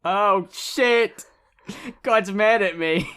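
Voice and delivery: high, loud panicked cry; panicked outburst. Retold or imitated. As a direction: yelp it out in sudden terror. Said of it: "And he's like".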